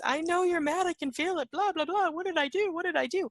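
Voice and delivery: high, loud nagging imitation; relentless pestering. Companion won't stop asking what they did, high loud badgering. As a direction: rattle on high and loud, mimicking an insistent friend. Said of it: "just won't stop with the continuous".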